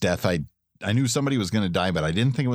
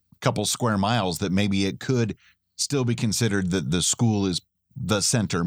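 ordinary talk, nothing marked

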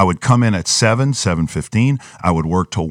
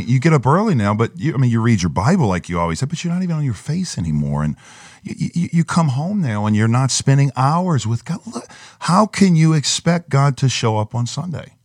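higher-pitched nagging, lightly acted; accusing inner voice; 6 semitones higher than usual. Narrative in his head before preaching, lightly acted accusing inner voice. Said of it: "that same little voice came in my head".

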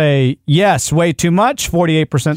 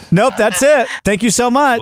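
high, bright customer reply; chirpy over clear scripted; 7 semitones higher than usual. Shown as a demonstration. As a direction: chirp it out cheerfully. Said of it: "no, I'll say".

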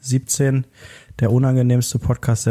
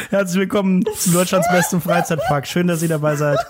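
laughing, half-shouted announcer welcome; laughing mock announcer; 4 semitones higher than usual. Host greets listeners as a theme park, laughing. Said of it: "mock theme park welcome".

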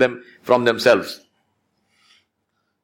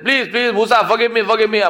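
higher-pitched imitated begging; mock tearful pleading; 9 semitones higher than usual. Qarun begs Musa as the earth swallows him, acted pleading. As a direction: plead urgently, lightly acting the tears. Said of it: "started now weeping and crying".

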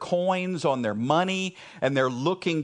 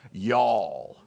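drawled Texan "Y'all" with a low falling tune; 7 semitones lower than usual. Shown as a demonstration. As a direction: say "Y'all" the Texas way, one slow syllable. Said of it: "In Texas, we say".

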